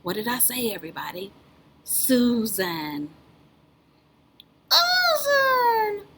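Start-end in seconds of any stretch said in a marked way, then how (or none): 4.7-6.0 high squeaky puppet calls out the name; a little puppet shouting eagerly; high cartoon shout; puppet Leo voice.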